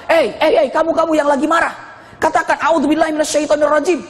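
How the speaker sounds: high-pitched, urgent telling-off; lightly acted, pushy correction of someone angry; self-righteous companion. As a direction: imitate an eager companion butting in. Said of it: "Ini sahabat ini merasa benar, dia langsung".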